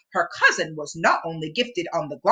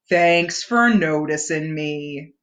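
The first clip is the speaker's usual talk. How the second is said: low, slow glum donkey; a put-upon old donkey; gloomy Eeyore; 5 semitones lower, slower than usual. A narrator's reading. Eeyore answering Pooh, low and slow.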